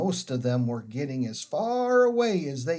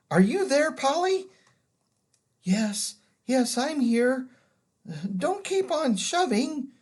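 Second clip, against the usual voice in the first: put-on girl's voice; 8 semitones higher than usual. Children call in the dark.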